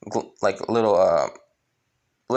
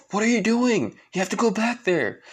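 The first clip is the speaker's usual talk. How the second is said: put-on much higher urgent girl's voice; pleading sister; 11 semitones higher than usual. Sister to Gauche flying her away, urgent protest. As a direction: scold him in a high, urgent pitch. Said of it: "with his sister saying".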